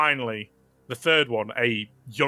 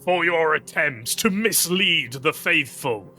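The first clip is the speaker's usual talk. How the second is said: declamatory condemnation, slowing to a grave close; solemn zealous judge, raised pitch then slow; Inquisitor passing sentence; 3 semitones higher than usual. Acted. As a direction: pronounce doom, spacing out the last phrases. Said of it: "the Inquisitor turns back to this young woman".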